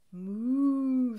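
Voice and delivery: a big bellowing cow moo; loud moo. A narrator's reading. Cow answering Duck with a loud moo. Cow's reply voiced.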